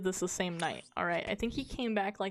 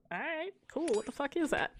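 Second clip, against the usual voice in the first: baffled reaction; 6 semitones higher than usual. Retold or imitated. Retelling his own baffled reaction.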